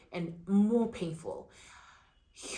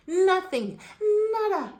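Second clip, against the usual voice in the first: indignant outburst; 12 semitones higher, 8 dB louder, slower than usual. Real, not acted. Indignant at no cervix check.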